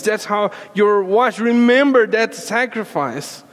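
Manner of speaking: impassioned sermon delivery; fervent preaching. Acted. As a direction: press the sacrifice home to the congregation. Preaching with rising intensity.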